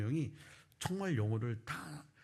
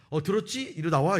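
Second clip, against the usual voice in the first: loud, raised-pitch summons; calling out; 5 semitones higher, 6 dB louder than usual. Retold or imitated. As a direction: imitate a boss calling someone out of hiding. Boss calling him from behind screen.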